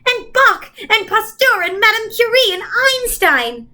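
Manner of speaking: high eager call-out. A narrator's reading. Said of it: "Charles Wallace called out".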